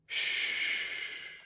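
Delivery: soft breathy whispered shh; gentle shush. Acted. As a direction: hush her gently. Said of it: "I gently breathed".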